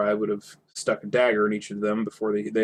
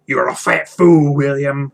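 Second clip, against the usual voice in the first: much louder, slow troll jeer; a troll sneering at his mate; troll insult; 4 semitones higher, 9 dB louder, slower than usual. Acted.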